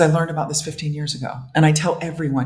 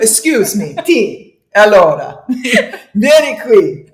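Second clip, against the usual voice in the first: higher, louder hammed-up Italian through laughter; mock Italian; 6 semitones higher, 7 dB louder, slower than usual. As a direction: play a laughing Italian mamma calling out.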